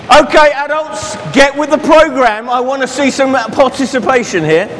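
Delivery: rally the grown-ups; calling out. Real, not acted. Teacher calling on the adults.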